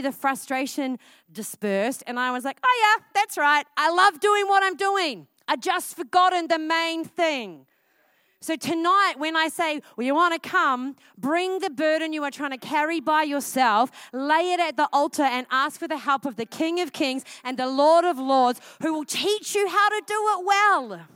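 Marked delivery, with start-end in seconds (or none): 2.6-7.7 high, loud burst of renewed delight; elated realization. Retold or imitated. Retelling sudden relief after repenting. 8.4-21.1 loud, raised-pitch altar call; full-force preaching. Real, not acted.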